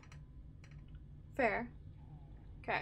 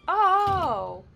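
surprised; 8 semitones higher, 13 dB louder than usual. Real, not acted. Startled while playing.

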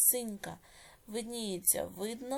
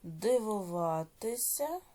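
slow, drawn-out syllables with rising ends; dictation; much slower than usual. Shown as a demonstration. Teacher dictating exercise eight to pupils, slow measured dictation. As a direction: dictate each word slowly, leaving long writing pauses.

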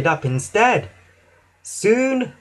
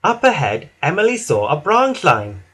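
usual speaking voice

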